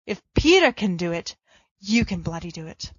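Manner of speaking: ordinary delivery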